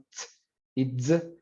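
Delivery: "ts" and "z" as isolated sounds starting from a full closure; slow phonetic demo of "ts" and "z"; affricate demo. Shown as a demonstration. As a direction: sound out "ts" and "z" slowly, tongue closing first. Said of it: "hay un cierre completo".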